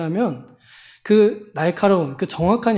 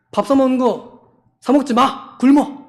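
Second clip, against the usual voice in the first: bossy church zealot; 5 semitones higher than usual. Retold or imitated. Mocking strict believer's commands.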